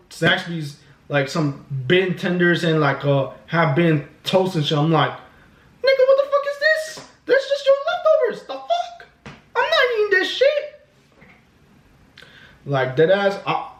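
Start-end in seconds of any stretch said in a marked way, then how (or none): 5.1-10.6 very high falsetto indignation; hammed-up, high-pitched protest; mock outrage. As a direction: squeal in comic disbelief and refusal. Retold outrage at being served leftovers.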